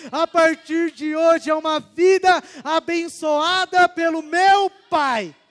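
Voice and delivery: loud, slowing proclamation of faith; fervent declaration prayer. Acted. Preacher leads listeners in a prayer, loud and fervent. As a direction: declare it to God with full force.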